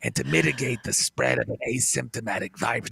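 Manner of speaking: deep, detached, droning Fauci voice; flat and bored, no emotion at all; very low monotone expert with halting pauses; Fauci impression. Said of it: "a guy who talks, uh, primarily in three-syllable words".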